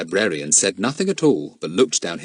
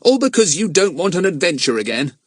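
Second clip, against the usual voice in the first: exasperated; 10 semitones higher, 4 dB louder than usual. A narrator's reading. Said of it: "said Jack, exasperated".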